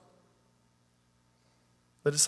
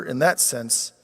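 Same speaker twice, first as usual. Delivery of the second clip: ordinary delivery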